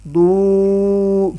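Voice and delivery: دو held as doooo; long doo. Shown as a demonstration. Teacher models the long vowel. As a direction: stretch دو's vowel. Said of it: "دو پیش وا".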